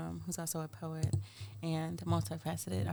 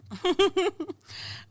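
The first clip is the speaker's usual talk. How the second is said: high-pitched burst of laughter; 12 semitones higher, 8 dB louder than usual. Real, not acted.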